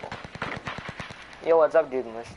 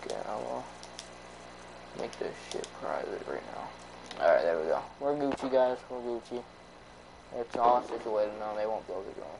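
cigar in mouth; 2 semitones lower, 7 dB quieter than usual. Real, not acted. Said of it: "Can't believe I have a freaking cigar in my mouth".

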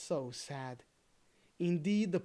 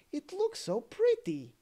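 put-on boy pleading; 11 semitones higher, 5 dB louder than usual. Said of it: "said the other boy".

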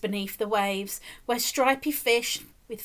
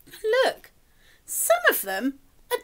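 excited look; 10 semitones higher, 4 dB louder than usual. Real, not acted. Reader pointing out to child.

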